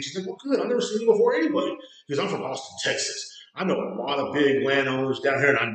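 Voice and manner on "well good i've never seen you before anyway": put-on high-pitched dismissive girl voice; imitated retort. Bartender answering a customer who swears he won't return, imitated retort. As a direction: mimic her comeback, voice pitched well up.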